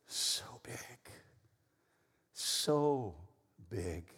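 soft, spaced-out, reverent words; hushed awe. Real, not acted. Preacher telling church how big God's love is, hushed awe. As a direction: let each word drop softly, with long pauses. Preacher turns game phrase to God.

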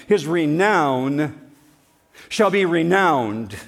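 burn every word in, slowly; drawn-out proclamation; slow emphatic. Shown as a demonstration. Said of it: "burn it into your mind".

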